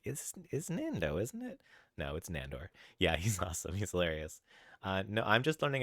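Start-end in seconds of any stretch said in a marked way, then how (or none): 0.0-1.6 very high-pitched unsure quote of oneself; mock questioning. Streamer imitating their own mistake to chat, high-pitched questioning. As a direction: squeak out a doubtful question, stammering at the start. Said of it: "I was like".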